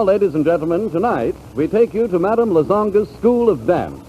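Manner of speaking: ordinary talk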